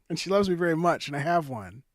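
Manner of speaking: loud laughing through the words; laughing loudly. Real, not acted. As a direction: laugh while you talk. Laughing at his own joke.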